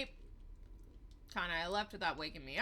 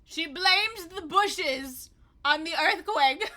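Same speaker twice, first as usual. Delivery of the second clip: mocking amusement; 8 semitones higher, 11 dB louder, much slower than usual. Real, not acted. Mocking game's logic.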